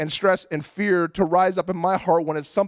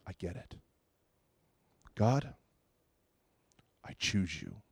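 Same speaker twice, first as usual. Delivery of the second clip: soft, breathy retold whisper, much quieter; 12 semitones lower, 12 dB quieter than usual. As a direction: barely whisper it, surrendering. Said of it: "a whisper coming out of my mouth".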